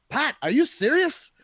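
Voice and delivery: loud, high-pitched bad Scottish accent; terrible Scottish Matthew. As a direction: exclaim it in a hammed-up Scottish accent. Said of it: "That is a terrible Matthew impression".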